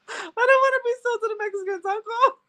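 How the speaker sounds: put-on high, whining kid voice; whiny child. Boy pleading with his uncle, high whiny child voice.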